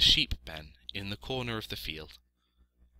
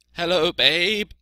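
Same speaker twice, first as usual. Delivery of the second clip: loud, higher bleating sheep voice; friendly sheep; 10 semitones higher, 12 dB louder than usual. A narrator's reading.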